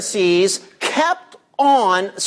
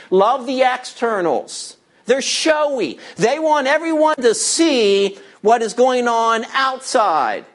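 usual speaking voice

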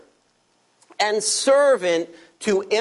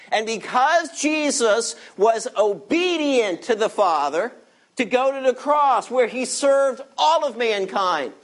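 usual speaking voice

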